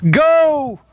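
sharp high command; master's sudden call to go; commanding shout. Retold or imitated. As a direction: bark the order like a master.

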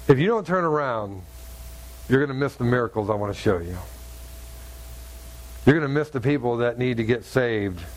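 low, grave, lightly voiced God; a hint of a solemn divine voice; God's voice. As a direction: speak low and weighty as God pleading. God speaking to the narrator.

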